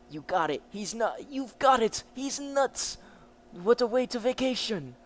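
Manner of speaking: high, loud disbelief; mock shocked sidekick; incredulous. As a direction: squeak out that he's nuts. Character reacts to Jojo.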